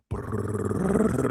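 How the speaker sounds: rolled-tongue drum roll; imitated drum roll. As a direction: trill out the drum roll of an entrance theme. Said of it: "Soon as that drum rolls".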